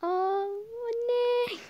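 a high, loud call down the hall. Acted. Daughter calling her mother from another room, loud call. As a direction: call for mom from your room.

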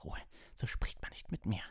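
high, whispery croak; hoarse, strained reprimand; croaking rage. A narrator's reading. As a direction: croak it out in choked fury. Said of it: "krächzte Snape".

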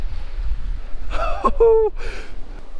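airy delighted laugh; breathy high laugh. Real, not acted. Laughing after catch.

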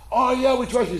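high, eager crowd gushing agreement. Acted. Crowd cheering the tightroper.

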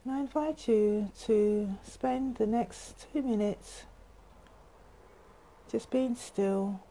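soft, unhurried breathing cues with long pauses; guided meditation. Real, not acted. Teacher guiding viewers through three gentle breaths, soft meditation voice. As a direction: hush the voice and let each instruction settle.

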